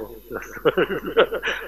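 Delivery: amused laugh, trailing on; chuckle. Real, not acted. Speaker amused after quoting worldly taunts, chuckling. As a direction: break into a chuckle.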